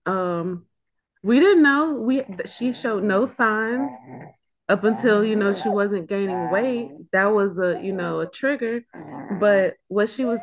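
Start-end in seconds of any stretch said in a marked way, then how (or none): none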